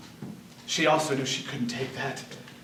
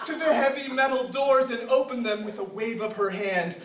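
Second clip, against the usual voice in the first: laughing; 6 semitones higher than usual. Real, not acted. Reader laughing through tragic story.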